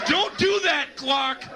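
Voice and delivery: high scolding that slowly settles; mock scolding. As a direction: scold a naughty clock, hammed-up. Scolding the imagined clock.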